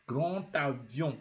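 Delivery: slow GRAND AVION with a linking T; D sounded as T. Shown as a demonstration. Teacher gives learners GRAND AVION slowly, D as T. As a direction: carefully join GRAND to AVION by T. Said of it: "you don't say".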